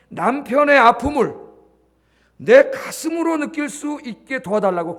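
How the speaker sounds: slow, earnest prayer with 내 stressed; 내 and 남편의 said loud and high, each word weighed; model prayer. Shown as a demonstration. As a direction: pray it slowly, leaning on 내 and 남편의. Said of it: "기도하세요".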